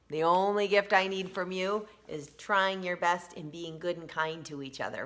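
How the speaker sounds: plain reading voice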